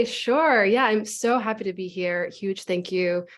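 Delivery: ordinary talk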